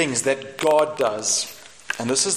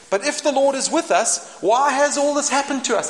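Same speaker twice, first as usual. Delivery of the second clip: high, complaining mock whine; whiny Gideon; 9 semitones higher, 3 dB louder than usual. Gideon protesting to the angel, whiny. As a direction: whine the question at heaven.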